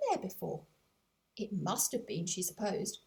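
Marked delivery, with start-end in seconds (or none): none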